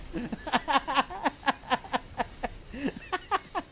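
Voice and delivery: long helpless giggle; real laughter. Real, not acted. Player amused by sticking with German, long laugh. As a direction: keep laughing till it dies down.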